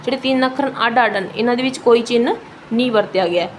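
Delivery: ordinary talk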